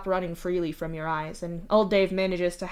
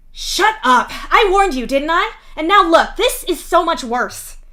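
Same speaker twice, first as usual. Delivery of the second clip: higher, loud angry scolding; snapping; 10 semitones higher, 10 dB louder than usual. Acted. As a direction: snap at him, told you so. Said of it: "Vriska snaps at him".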